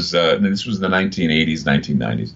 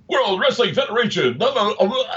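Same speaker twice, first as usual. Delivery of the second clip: much higher, booming wrestling announcer; hammed-up wrestling announcer; ring announcer puking; 12 semitones higher than usual. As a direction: sell it like a ringside hype man. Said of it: "you know, they're puking".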